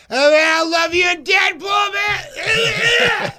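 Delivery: high, full-throated heckler shout; imitated rowdy yelling. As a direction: yell it like a drunk fan at a comedy show. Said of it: "they're yelling stuff like".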